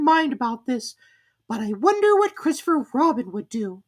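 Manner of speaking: a tiny worried piglet wishing aloud. Acted. Piglet sighs to himself, stuck alone in the rain.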